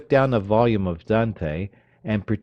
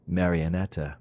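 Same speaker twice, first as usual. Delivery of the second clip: ordinary delivery, nothing marked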